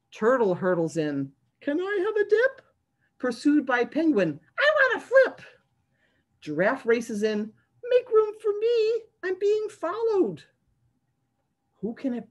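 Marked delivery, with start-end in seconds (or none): 1.6-2.6 much higher cartoon critter; a tiny turtle asking politely; squeaky turtle voice. Turtle asks the kids for a dip, high and squeaky. 4.6-5.4 much higher and louder, eager; a penguin bursting to play; excited penguin voice. Acted. Penguin begs to flip in the tub, high and eager. 7.8-10.4 much higher, urgent cartoon voice; a giraffe in a rush; hurried giraffe voice. Giraffe pushes into the tub, high and urgent.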